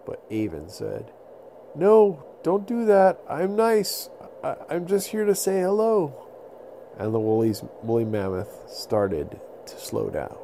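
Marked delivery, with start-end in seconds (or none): 1.8-6.1 calm the beast down, pitched up; pleading little boy. Acted. Little boy pleading with the mammoth not to charge.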